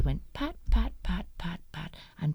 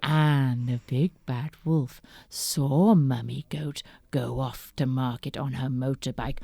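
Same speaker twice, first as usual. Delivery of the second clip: ordinary delivery, nothing marked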